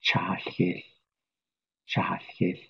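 "cha, hish" whispered with a lateral hiss; Navajo sound drill. Shown as a demonstration. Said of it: "And the last sound".

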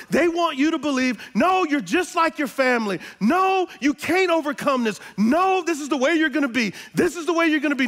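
loud, high preaching voice; rising pulpit fervor; full-force preaching. Acted.

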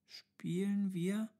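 put-on high, slow child's question, rising; uncertain, smiling. A narrator's reading. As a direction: ask hesitantly, with a smile in the voice. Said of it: "lächelte der Kleine unsicher". (unsicher fragende, hohe Kinderstimme)

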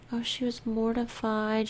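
usual speaking voice

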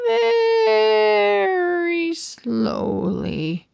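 very slow, stretched words; drawn out slowly. Acted. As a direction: drag every syllable out. Research dragging on.

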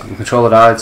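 usual speaking voice

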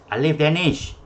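loud, high, insistent call. Real, not acted. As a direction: summon a student who isn't responding. Calling silent student to unmute.

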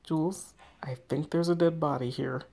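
ordinary delivery

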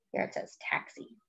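ordinary delivery, nothing marked

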